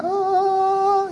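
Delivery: long, high held "oh"; mock heavenly rising. Audience shown how you die and rise, mock heavenly "oh". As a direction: sustain a high "oh" as if floating upward.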